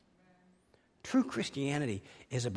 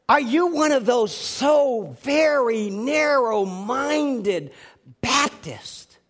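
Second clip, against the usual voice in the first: slow, higher, louder mock indignation; mad questioning; 9 semitones higher, 10 dB louder, much slower than usual. Woman confronting Huckabee, slow mock-indignant question. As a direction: hammer each word like an offended questioner. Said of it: "And she got mad".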